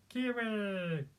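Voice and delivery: slow high creature squeal; Kiwi. Shown as a demonstration. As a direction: stretch it out in a little creature voice. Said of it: "I call it Kiwi".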